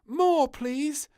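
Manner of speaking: high, loud eager request; piping plea; little princess. A narrator's reading. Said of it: "said the little princess".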